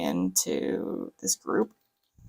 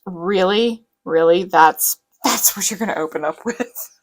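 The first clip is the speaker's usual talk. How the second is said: incredulous; 7 dB louder than usual. Acted. Acted disbelief at his opening line.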